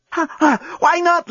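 high, slow gasped question; panting passion. A narrator's reading. Lucy asking mid-embrace, not really caring, panting. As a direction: ask between gasps. Lucy asks amid lovemaking.

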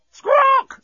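very high, loud bird squawk; parrot screech. A narrator's reading. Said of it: "screamed something else".

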